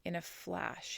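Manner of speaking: ordinary delivery, nothing marked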